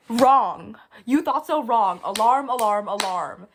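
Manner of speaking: loud, gleeful game-show wrongness call; mock alarm. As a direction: blare it out like a playful warning siren. Mock buzzer after rhetorical "right?.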